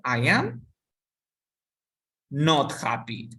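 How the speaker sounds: "not" hit and held, then happy slowly; "I am not happy" with "not" slowed and stressed. Shown as a demonstration. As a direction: lean on the word "not" for the class. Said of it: "voy a ponerle solamente el not".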